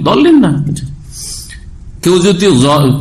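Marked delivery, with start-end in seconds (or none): 0.1-0.5 mock insistence. Mocking the strict dal readers.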